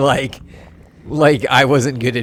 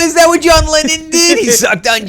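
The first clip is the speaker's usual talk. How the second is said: much higher, loud incredulous cry; shocked outburst; 14 semitones higher, 7 dB louder than usual. Real, not acted. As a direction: gasp it out in disbelief. Reacting to absurd chat line.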